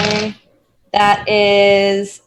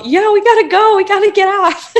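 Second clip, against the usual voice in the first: high, louder urgent call; yell; 13 semitones higher, 6 dB louder than usual. Pretend. Player calling companions to get off, mock yell. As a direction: call out to the group, pitched well up. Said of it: "I think I would yell".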